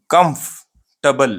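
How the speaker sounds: "कंफर्टेबल" slowly in two parts, KUMF, tuh-bul; slow "kumf", pause, reduced "tuh-bul"; right pronunciation. Shown as a demonstration. Teacher spells out the word, slow two-part "कंफर्टेबल". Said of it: "ये right pronunciation है".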